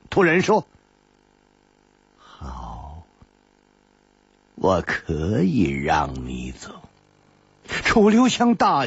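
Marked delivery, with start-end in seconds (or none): 2.2-6.8 put-on low, slow old woman granting leave; a deep, measured crone; old woman's voice. A narrator's reading. Old woman answers Chu Liuxiang.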